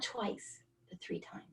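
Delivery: whispered. Real, not acted. Dramatic reveal of near deaths.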